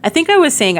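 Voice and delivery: normal talking voice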